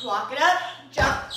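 loud, punchy workout cues; loud coaching call. Real, not acted. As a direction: call it out over the music. Coach calling moves mid-workout.

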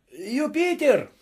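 high call up the tree. A narrator's reading. Master calls up to servant, shouting. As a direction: call his name upward. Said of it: "закричал он".

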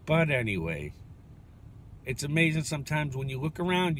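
ordinary delivery

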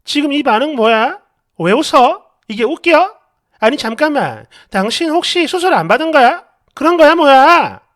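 acted wife's rising, indignant questioning; suspicious confrontation. Acted wife confronts laughing husband.

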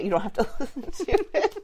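cracking up mid-sentence; laughing. Real, not acted. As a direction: laugh through the words. Joking, breaks into laughter.

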